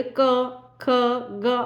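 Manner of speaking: क, ख, ग voiced from the throat, each one separate; letters sounded out. Shown as a demonstration. Teacher showing students क, ख, ग come from the throat. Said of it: "उच्चारण करेंगे तो आप समझ पाएंगे कि गले से आवाज आ रही है".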